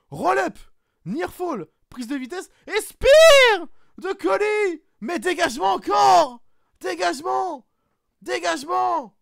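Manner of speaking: shouted play-by-play, high and loud; commentateur qui crie. Acted. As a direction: hurle chaque dégagement comme en direct. Calling near falls live. (commentaire crié, voix haute)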